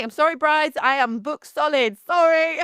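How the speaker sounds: high, slow fake-sorry to brides; mock apologetic. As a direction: parody a humble-brag to brides. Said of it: "why you say".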